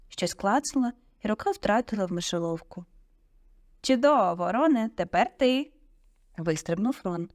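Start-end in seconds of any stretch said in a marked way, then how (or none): 3.8-5.7 loud, high, pleased cue; teacher cheering the next pupil on; calling out. A narrator's reading. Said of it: "професор Люпин вигукнув".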